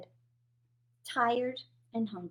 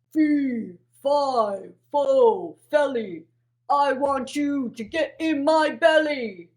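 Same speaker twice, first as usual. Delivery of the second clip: loud booming giant; 6 dB louder, slower than usual. Acted. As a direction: boom it out like a giant. Giant's famous chant.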